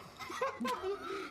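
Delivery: short high burst of laughter. Real, not acted. Laughing at his dull story.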